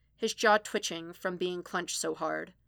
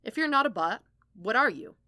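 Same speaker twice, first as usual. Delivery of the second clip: higher, louder challenge; confrontational demand; 3 semitones higher, 5 dB louder than usual. Acted. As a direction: press the question hard, voice raised. Foggy confronts Matt after interrupting.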